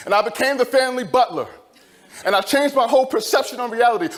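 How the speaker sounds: ordinary talk, nothing marked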